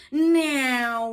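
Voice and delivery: loud, high neeow like a toy plane; aeroplane noise. Acted. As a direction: zoom it like a child playing aeroplane. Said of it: "went Baby Bear".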